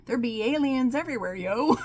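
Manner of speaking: gleeful loud exclamation; playful hype. Real, not acted.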